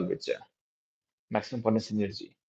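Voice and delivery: ordinary delivery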